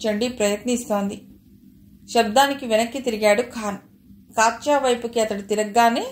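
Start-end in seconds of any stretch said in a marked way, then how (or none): none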